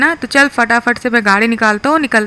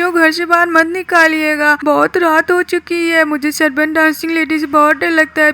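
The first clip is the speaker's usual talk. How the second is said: put-on higher, begging little boy voice; pleading kid Kroots; 5 semitones higher than usual. Kroots begging his aunt not to kick him out, higher pleading kid voice. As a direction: beg the aunt not to throw you out.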